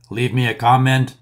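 usual speaking voice

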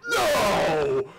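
loud, high, falling shout of dismay; anguished scream. Real, not acted. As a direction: scream it as it all slips away. Losing progress, screams no.

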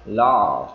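"law" held long with an open o; open long vowel. Shown as a demonstration. Teacher warning against closed mouth, "law" long and open. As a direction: keep the mouth open through a stretched "law". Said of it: "đừng có ngậm miệng".

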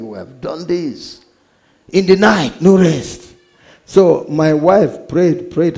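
normal talking voice

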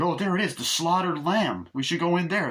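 high, swooping eager quote; hammed-up delight, pitched well up; acted excited discovery. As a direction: play a traveler spotting the place. Acts out travelers spotting the pub.

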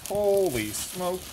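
slow drawn-out amazement; amazed. Real, not acted. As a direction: stretch it out in awe. Amazed at find.

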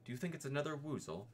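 a nervous piglet hoping he is wrong; anxious little Piglet. Acted.

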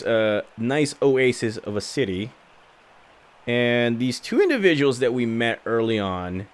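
ordinary talk, nothing marked

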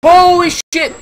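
high, loud panicked yelling, fast; panicked screaming. Real, not acted. Gamer yelling at the monster chasing them, panicked screaming. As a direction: shriek in real terror as it closes in. Chased in horror game.

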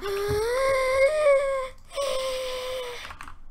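long, loud, high-pitched squeal. Real, not acted. Gamer losing it mid-match, long high squeal. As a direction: hold a piercing squeal for seconds. Long outburst during the game.

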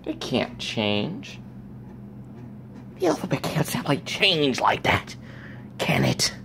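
sarcastic imitation of people who think the alphabet is fixed; mocking skeptics. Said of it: "I hate when people think that".

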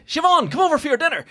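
put-on loud, high calling in Traveller accent; mock Traveller accent. Parent calling a girl in for dinner, shouted Traveller accent. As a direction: holler across the park in a put-on Traveller accent. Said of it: "you all thought that was a Derry accent".

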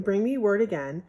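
usual speaking voice

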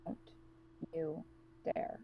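hissed low warning; threatening whisper. Acted. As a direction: warn them quietly, deadly serious.

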